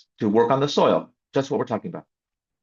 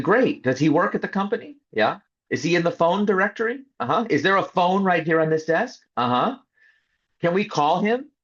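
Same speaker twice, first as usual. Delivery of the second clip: higher, leading questions one after another; pointed questions; 8 semitones higher than usual. Retold or imitated. To the team about the general counsel, higher leading questions. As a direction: lead them step by step to the obvious.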